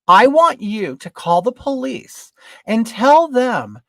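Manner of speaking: loud, slow, punched-out order; indignant demand. Retold or imitated. Customer orders the man to call police, loudly indignant. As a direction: lay down the challenge word by word.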